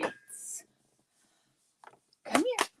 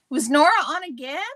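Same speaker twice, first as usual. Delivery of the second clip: surprised question; 3 semitones higher, 8 dB louder, faster than usual. Real, not acted.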